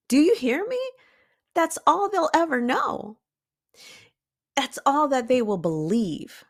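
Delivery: high-pitched, insistent appeal; emphatic insistence. Real, not acted. Speaker pressing listeners about what the child learns, emphatic. Driving her point home to listeners.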